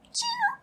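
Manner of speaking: high squeaky chirp with a rise; beaver chirp. Retold or imitated. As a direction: squeak it like a tiny animal. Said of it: "And she just, chirp".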